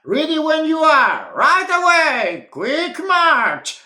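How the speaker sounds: high, loud march command; boot marching call. Acted. Boots setting off again, high loud march call. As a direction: snap out the march order.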